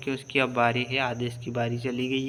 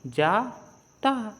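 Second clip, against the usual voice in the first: "जाता" very slow, split in two higher syllables; sounding out; 8 semitones higher, much slower than usual. Shown as a demonstration. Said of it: "तो".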